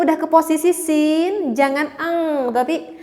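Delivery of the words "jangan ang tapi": ordinary delivery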